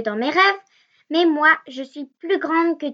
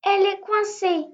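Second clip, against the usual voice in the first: high, frightened plea that gets louder; pleading for help; 7 semitones higher, 3 dB louder than usual. Acted. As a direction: beg to be freed, scared. Trapped girl calls for help. (supplication aiguë et effrayée)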